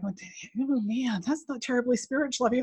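hushed inner voice, lightly played; self-reproach aside. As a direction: murmur a mock telling-off to yourself. Acting out her self-talk.

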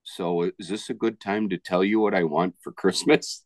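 usual speaking voice

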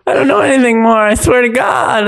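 high, panicked mock plea; hammed-up terrified crook, high and desperate; bad guy pleading. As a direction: plead for your life in a high voice. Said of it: "the bad guy's like".

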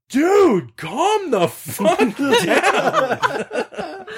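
very high, drawn-out mock scream; imitated screaming. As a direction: shriek it across a parking lot, breaking into laughter. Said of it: "he's screaming".

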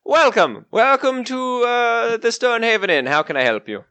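innkeeper welcome with a light Irish lilt; a warm Irish-tinged welcome; Irish accent slipping in. Acted. Innkeeper Lewis greeting returning guests, lightly Irish. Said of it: "Now he's Irish".

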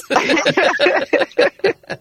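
high loud burst of laughter; bright laugh. Real, not acted. Laughing at her own story.

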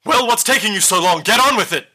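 loud, high yell to hurry them up; impatient yell. Acted. Villain yells at three people on the ramparts, impatient yell. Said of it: "and she yells".

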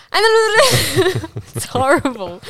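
slow, laughing through it. Real, not acted. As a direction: giggle out the words.

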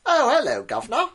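high, loud mock Cockney greeting in a British accent; hammed-up British "governor" voice; silly British accent. Shown as a demonstration. Co-host showing John an easy phrase in silly British accent. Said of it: "easy to say in a silly-sounding British".

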